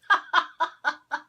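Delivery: high, loud burst of laughter; real laugh bursting out. Real, not acted. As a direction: crack up at a joke. Co-host's joke.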